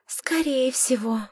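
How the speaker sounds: sighing mermaid. Acted. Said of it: "вздохнул третий".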